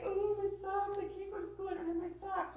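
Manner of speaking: high-pitched mock panic; child freaking out. Child freaking out over socks before school, panicky. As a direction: whine in distress like an upset kid. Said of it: "they're freaking out about something".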